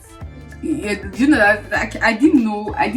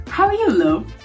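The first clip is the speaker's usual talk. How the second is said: higher-pitched greeting, falling away at the end; imitated local greeting; 5 semitones higher than usual. Local stranger greeting a newcomer, lilting imitation. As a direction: mimic a friendly stranger's lilting hello.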